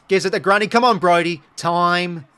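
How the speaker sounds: loud, high, urgent cheering; excited cheering. Real, not acted. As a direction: yell the team on as the goal comes. Fan cheering a crucial goal.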